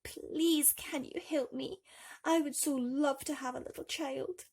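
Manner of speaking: pleading wife. Acted. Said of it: "went to a wise old woman and said".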